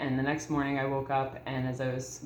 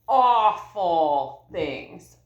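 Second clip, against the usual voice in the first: drawn out very slowly and loud; slow, loud, pained awful; anguished; 2 semitones higher, 6 dB louder, much slower than usual. Real, not acted. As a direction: drag the word out in pain. Recalling father's abuse.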